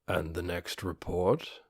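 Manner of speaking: low, soft, measured voice of a skeletal ruler; a calm dark lord thanking his servant; undead lord voice. A narrator's reading. Ainz voiced in story.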